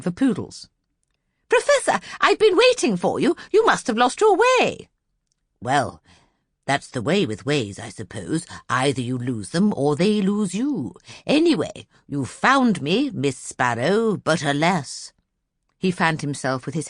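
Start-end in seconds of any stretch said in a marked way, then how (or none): 1.5-4.9 much higher, fussy society lady. Acted. Miss Lark scolding the late Professor, high and fussy. 5.6-15.1 lightly voiced vague old professor; absent-minded professor. The Professor musing to Miss Lark, a lightly voiced vague old man.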